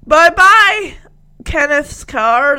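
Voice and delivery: loud high singsong goodbye; mock farewell. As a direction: wave the car off cheekily.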